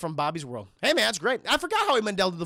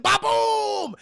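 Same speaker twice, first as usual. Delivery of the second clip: high, loud, drawn-out boom; imitated crash boom; 12 semitones higher, 5 dB louder than usual. Storyteller imitating a plow bursting from the garage, big hammed-up boom. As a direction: boom it out like an explosion.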